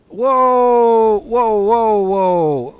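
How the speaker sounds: loud, high, drawn-out whoas; alarmed shout. Real, not acted. Driver in a near miss, alarmed shout. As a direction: cry out as the truck nearly crashes. Near crash while driving.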